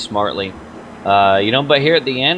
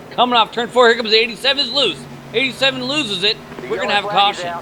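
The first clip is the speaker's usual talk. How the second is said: high, urgent play-by-play as a car spins; sudden spike of excitement in the booth; excited race call; 9 semitones higher than usual. Real, not acted. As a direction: call the spin with rising alarm. Crash call during live race.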